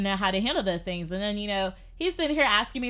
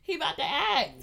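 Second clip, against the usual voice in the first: scared; 12 semitones higher, 3 dB quieter than usual. Retold or imitated. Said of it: "like, I was like".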